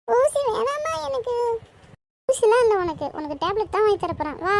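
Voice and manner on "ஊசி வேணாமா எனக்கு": high, slow tearful wail; whiny pleading. Acted. Child begs mother for no injection, whiny pleading. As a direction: drag out the plea, crying.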